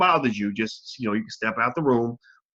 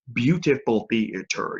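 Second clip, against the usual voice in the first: slow, syllable-by-syllable stress on "Beautiful theater"; drawn-out emphasis; slower than usual. Real, not acted. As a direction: stretch out each syllable of "Beautiful theater" to savor it. Repeats it slower for emphasis.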